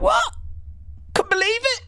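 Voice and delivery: very high, squeaky mock shock; retold astonishment. Streamer reenacting her discovery about Mariah, squeaky astonishment. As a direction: act out stunned disbelief way up high. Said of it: "I was like".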